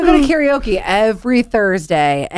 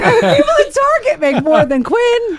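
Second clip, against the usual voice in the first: laughing; 12 semitones higher, slower than usual. Real, not acted. Teasing about coworker's low pay.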